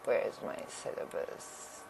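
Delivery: soft, breathy search under her breath; whispering aside while searching; whispered to herself. Real, not acted. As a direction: mutter it to yourself while looking.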